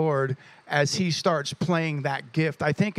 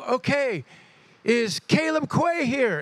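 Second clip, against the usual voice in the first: higher, drawn-out call to a room; raised pitch, tentative announcement; 7 semitones higher, slower than usual. Retold or imitated. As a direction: re-enact calling someone out from the stage. Acts calling out across a crowded room.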